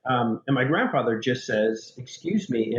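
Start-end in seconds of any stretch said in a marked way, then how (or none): 2.0-2.8 understated mock Italian accent; Italian affectation. Grandfather imitating great-grandfather at the casket, slight Italian accent. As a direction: lightly mimic an Italian affectation. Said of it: "using my great-grandfather's affectation".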